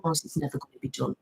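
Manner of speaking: ordinary talk, nothing marked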